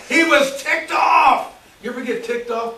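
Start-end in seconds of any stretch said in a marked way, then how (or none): 0.1-1.5 higher, louder, slow shout of anger; angry shout. Acted. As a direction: bellow how mad Cain was. Preacher shouts Cain's anger.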